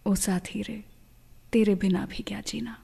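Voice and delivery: ordinary talk, nothing marked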